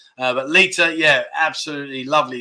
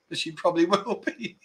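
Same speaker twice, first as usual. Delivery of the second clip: stifled laugh; 3 semitones higher, 6 dB quieter than usual. Real, not acted. Cracking up at own joke.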